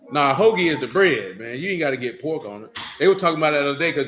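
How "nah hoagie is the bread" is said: usual speaking voice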